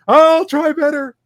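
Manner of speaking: put-on high, slow, small child voice; little girl. Eight-year-old girl answering the disappointed priest. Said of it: "the next person".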